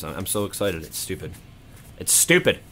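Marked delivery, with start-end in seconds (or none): 0.8-1.3 much lower and softer, almost to himself; stunned murmur. Real, not acted. Repeats the phrase while stunned. 2.0-2.6 higher and louder, punching stupid; excited outburst. Real, not acted. Repeats the phrase louder.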